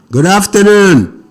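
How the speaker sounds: loud, slow phone greeting; speaking up. Real, not acted. Repeats greeting louder for caller.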